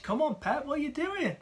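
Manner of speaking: a fan throwing up his hands at the manager; exasperated. Real, not acted. Fan exasperated with Pep's transfer plans, pleading high.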